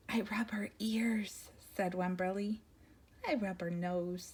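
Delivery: soft shy whispers. Acted. Two shy girls whispering about their dolls. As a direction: hush it like a secret.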